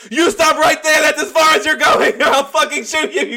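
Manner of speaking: high, loud mock threat; threatening guard. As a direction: bark the threat like a wound-up guard. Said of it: "and he's like".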